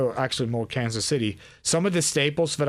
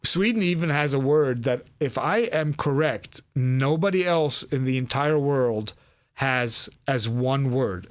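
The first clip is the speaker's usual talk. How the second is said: ordinary talk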